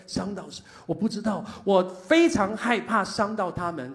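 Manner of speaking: 1 desperate pleading prayer. Retold or imitated. Retells desperate prayer in car.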